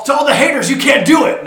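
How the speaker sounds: loud, high mock rant at the haters; Stephen A. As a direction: shout it out as a hammed-up sports-pundit rant. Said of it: "me getting my Stephen A on".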